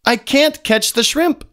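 high storybook yell; fish yells. A narrator's reading. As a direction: cry it out as the fish. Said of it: "yells the fish".

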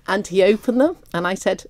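ordinary talk, nothing marked

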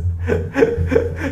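airy high giggle; breathy amused laugh. Real, not acted. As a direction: laugh at the joke. Laughs at his own joke.

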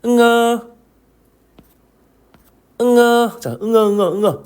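응아 with a nasal ng onset, held; read-aloud drill. Shown as a demonstration. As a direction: model 응아 on a high level tone. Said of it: "한번 읽어볼게요".

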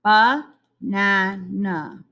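Banana spoken very slowly, each syllable ba-na-na drawn apart; syllables stretched slowly. Shown as a demonstration. Teacher to young pupils, slowly stretching Banana into syllables. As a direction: pull Banana apart into three slow beats. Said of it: "Three syllables".

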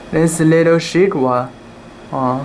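ordinary delivery, nothing marked

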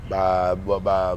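normal talking voice

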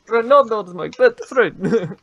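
put-on high, loud, slow Russian accent; heavy joking Russian accent; mock Russian accent. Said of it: "Now I'm Russian".

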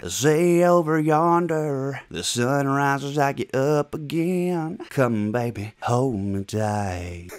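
very slow, drawn-out Southern twang; slow Southern country ballad drawl; country accent. Acted. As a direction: croon it like a country song, stretching every word. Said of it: "somebody from the fucking South".

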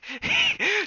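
high loud laugh; burst of laughter. Real, not acted. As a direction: laugh at own slip. Laughing at own repeated stumble.

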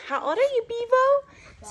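high, sing-song pet voice. Real, not acted. Owner asking her dog his age in high baby talk.

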